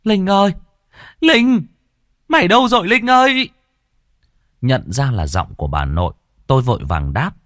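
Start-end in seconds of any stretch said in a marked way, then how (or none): 0.0-3.5 put-on grandmother screaming for the child, much higher and louder; frantic calling. A narrator's reading. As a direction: call out in panic across the house. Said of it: "bà nội kêu lên thất thanh".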